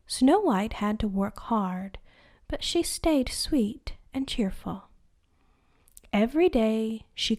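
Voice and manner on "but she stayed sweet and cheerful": usual speaking voice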